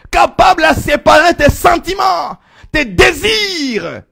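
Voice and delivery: high, loud sermon with drawn-out key words; full-force preaching. Acted. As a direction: thunder out the doctrine to the congregation.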